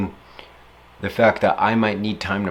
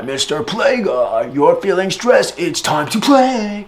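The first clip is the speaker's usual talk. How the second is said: higher, louder hype persona; Mister Play Guy; 9 semitones higher, 6 dB louder, slower than usual. Pretend. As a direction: announce yourself like a playful mascot. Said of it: "I'm the play guy".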